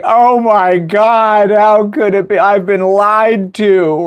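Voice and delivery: high, slow, drawn-out wail; mock bawling. Little sister learns Santa isn't real, mock bawling. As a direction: imitate a kid bawling in betrayal. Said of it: "she just starts bawling".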